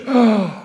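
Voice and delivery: sharp relieved intake of breath; acted gasp. Teacher voicing the hall's relieved gasp at the vote. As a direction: gasp like the watching crowd. Hall reacts to Soviet vote.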